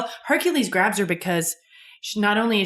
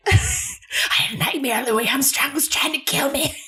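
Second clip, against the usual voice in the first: put-on whiny old mother; 8 semitones higher than usual. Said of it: "the mom from Throw Mama from the Train".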